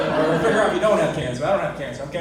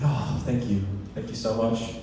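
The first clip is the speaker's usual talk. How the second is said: lower, softer grateful sigh; relieved thanks; 8 semitones lower, 6 dB quieter than usual. Retold or imitated. To the doctor with good news, soft relieved thanks. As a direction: let the relief out softly. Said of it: "I was like".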